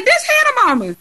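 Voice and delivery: loud, high excited call; retold yell. Telling Taylor across the mixer who this is, an excited high yell. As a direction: shout the news across the room. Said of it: "I think I yelled across the room".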